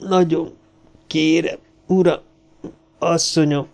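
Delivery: broken-up plea of a dying man. A narrator's reading. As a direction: groan each word out between pauses. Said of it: "nyögte el haló hangon".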